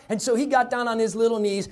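usual speaking voice

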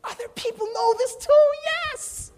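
very high, loud, hammed-up excitement; amazed discovery. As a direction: exclaim it in squealing mock wonder. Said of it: "we were like".

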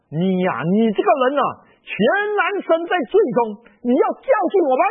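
loud, high rebuke, speeding at the end; angry rebuke. Retold or imitated. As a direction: scold him from their pride. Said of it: "他们回答说".

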